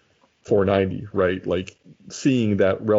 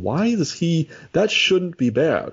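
higher-pitched, drawn-out bafflement; puzzled disbelief; 8 semitones higher, slower than usual. Acted. Fan puzzling over a player's poor results, baffled. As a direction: question it in a high, incredulous voice. Voicing a puzzled look at ratings.

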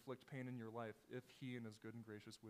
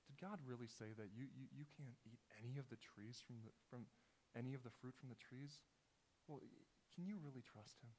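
softer, hushed tempter voice; quiet, coaxing tempter; Satan whispering; 6 dB quieter than usual. Retold or imitated. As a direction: murmur it like a serpent's temptation. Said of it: "a lie that Satan whispers into the ears".

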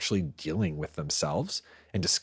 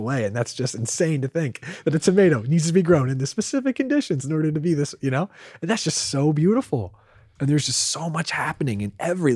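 high, loud wonder; excited; 7 semitones higher, 5 dB louder than usual. Real, not acted. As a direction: gush about it in awe. Rapt, rising enthusiasm.

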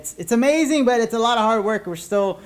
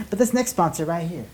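ordinary delivery

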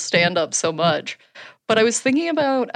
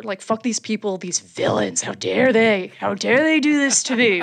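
mock outrage.

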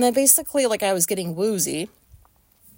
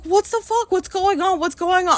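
alarmed friend; 10 semitones higher, 3 dB louder than usual. Retold or imitated. Friend asks what's happening.

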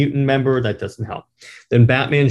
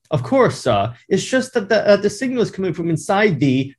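much higher, polite butler voice; a fussy butler, pitched way up; Alfred; 7 semitones higher than usual. Retold or imitated. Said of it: "I'll be, uh, Alfred this part".

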